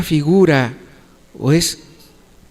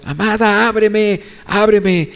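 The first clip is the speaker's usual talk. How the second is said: raised-pitch pleading call; mildly acted calling out; 6 semitones higher than usual. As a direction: call to a beloved through a closed door. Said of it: "dando voces".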